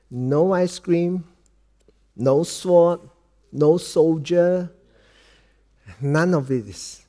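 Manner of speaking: each item slow and spaced out; slow, solemn fatherly tone. Retold or imitated. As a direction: count them off slowly and gently. Father solemnly tells son no rewards.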